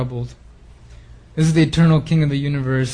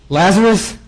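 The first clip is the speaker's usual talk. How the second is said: calling Lazarus out, raised and higher; loud call; 4 semitones higher, 3 dB louder, slower than usual. Retold or imitated. Jesus calling Lazarus out of the tomb, loud call. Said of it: "he cried out with a loud voice".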